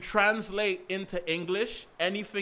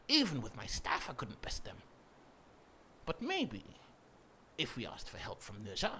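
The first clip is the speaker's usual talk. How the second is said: Sun Wukong; 5 dB quieter than usual. Shown as a demonstration. Streamer improvising a legendary monkey king's lines, haughty theatrical voice. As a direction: declaim with long pauses, dropping into breathy whispers. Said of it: "doing what I would think Wukong would sound like".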